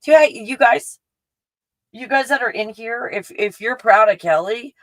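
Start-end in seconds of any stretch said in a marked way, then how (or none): none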